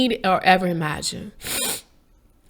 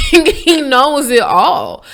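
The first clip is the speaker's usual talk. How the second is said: laughing emphasis; 5 semitones higher, 6 dB louder, much slower than usual. Real, not acted. Laughs while driving point home.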